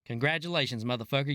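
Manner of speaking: normal talking voice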